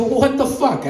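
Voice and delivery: high, indignant squawk. Retold or imitated. Telling off his girlfriend, a high indignant yelp. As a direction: yelp it out in mock outrage. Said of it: "I go".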